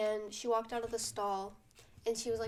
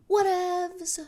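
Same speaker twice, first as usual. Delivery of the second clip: high, loud, sassy brush-off; dismissive; 8 semitones higher, 10 dB louder than usual. Real, not acted. Vlogger dismissing the girl's drama, loud sassy whatevs. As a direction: toss it away with attitude.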